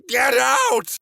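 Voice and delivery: high-pitched shout, stretching "out"; panicked yell. A narrator's reading. Naked son to parents in his room, panicked yell. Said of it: "I try to yell".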